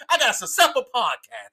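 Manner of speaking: ordinary talk, nothing marked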